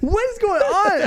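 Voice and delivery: high-pitched, drawn-out bewildered cry; exasperated disbelief. Retold or imitated. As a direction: play up total bewilderment, voice way up high. Retold bewilderment at being ordered around.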